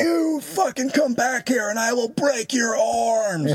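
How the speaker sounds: high, drawn-out retold shout; slow, high-pitched mock scream; crazy-guy screaming. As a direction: re-enact screaming threats like a madman. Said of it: "like a crazy guy screaming".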